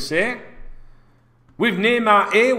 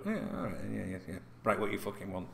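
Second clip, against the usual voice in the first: mock quiet giving in; 9 semitones lower, 15 dB quieter, faster than usual. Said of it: "And then I go".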